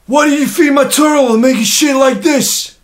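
loud, high New York accent; hammed-up Brooklyn exclamation, slowed down. Shown as a demonstration. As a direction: shout it like a Brooklynite. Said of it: "New York, Brooklyn".

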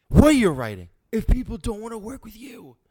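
ordinary delivery

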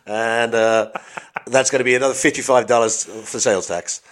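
hammed-up American cashier accent, laughing through it. A cashier adding sales tax for a British customer, in a mock American accent. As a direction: rattle off the bill like an American clerk. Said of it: "that accent that Baz has just done".

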